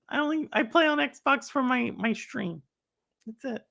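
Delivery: ordinary delivery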